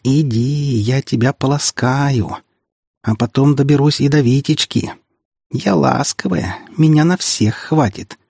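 sugary menace, taking your time; menacing mock tenderness. A narrator's reading. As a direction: taunt them with stretched sweet threats. Girl threatens with mock tenderness.

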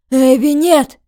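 loud cry of warning; desperate shout. Acted. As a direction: shout her name to stop her.

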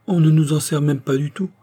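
put-on girlish grievance, voice lifted; slight pout. A narrator's reading. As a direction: protest with a little pout. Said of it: "avec une légère moue".

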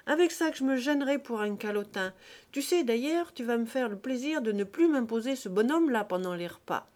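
a scornful freethinker bellowing at his friend; indignant outcry. Acted. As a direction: shout down the husband's timid remark. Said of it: "Joseph aussitôt s'écria".

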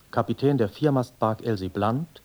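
normal talking voice

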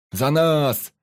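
loud, high, drawn-out cheer; echoed toast. A narrator's reading. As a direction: shout it back as the crew.

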